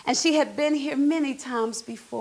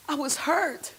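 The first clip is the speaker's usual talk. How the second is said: ordinary delivery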